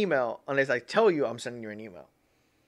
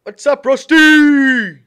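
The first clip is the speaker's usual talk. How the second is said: much higher, louder, slow drawn-out call; loud greeting; 12 semitones higher, 19 dB louder, much slower than usual. Real, not acted. Streamer spots Rusty in chat and greets him with a loud, drawn-out call. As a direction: call out a big hello across the room.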